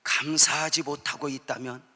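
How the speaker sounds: usual speaking voice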